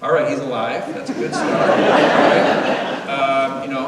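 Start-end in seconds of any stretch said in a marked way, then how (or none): 0.0-1.8 relieved laugh. Retold or imitated. Passenger hearing the captain, relieved and laughing. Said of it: "And I was like". 1.8-3.0 big high airy burst of laughter; loud laugh. Real, not acted. Speaker to the audience, laughing hard. Laughs at his own joke.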